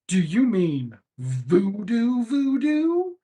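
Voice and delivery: drawn-out, baffled question, slowed way down; slow puzzled Charlie. Retold or imitated. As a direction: play Charlie as slowly confused. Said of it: "Charlie's like".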